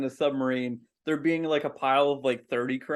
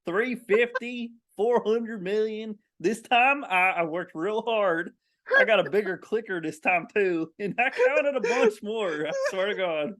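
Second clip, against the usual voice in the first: lightly put-on country accent, a bit higher; hammed-up proud rural crab counter reporting; 4 semitones higher than usual. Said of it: "a dude named Cletus with that accent".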